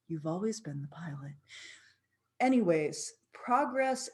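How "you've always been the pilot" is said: softer, hushed and meaningful; eerie whisper. Retold or imitated. Stewardess revealing a truth to the passenger, whispered. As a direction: whisper it like a revelation. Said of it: "And she says".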